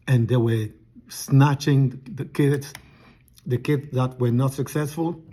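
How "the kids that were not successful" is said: ordinary talk, nothing marked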